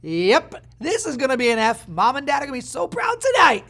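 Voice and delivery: loud mock cheer, climbing in pitch; hammed-up sarcastic pride; sarcastic test-taker. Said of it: "and you're like".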